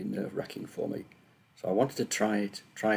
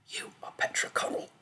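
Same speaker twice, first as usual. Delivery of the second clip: voiceless whisper of a voice in her head; inner voice whisper. A narrator's reading. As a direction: breathe it as a thought in her mind. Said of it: "the girl thought".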